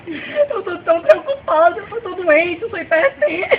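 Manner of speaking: mother sobbing to the policeman about the stolen money. Acted. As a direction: wail it out like a distraught mom begging for help. Mother crying, telling of illness.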